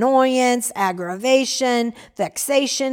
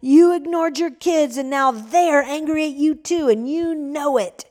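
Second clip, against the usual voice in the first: angry accusation; 5 semitones higher than usual. Acted. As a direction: throw the blame at the old man. Young man shouting accusations.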